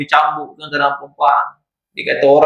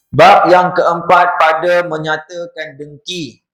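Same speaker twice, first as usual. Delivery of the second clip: chapter heading read; 3 semitones higher, 3 dB louder, slower than usual. Real, not acted. Announces the next book chapter.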